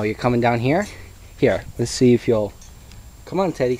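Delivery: ordinary talk, nothing marked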